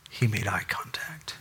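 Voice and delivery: hushed whisper for effect. Acted. Preacher telling congregation, whispering. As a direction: lean in and whisper it.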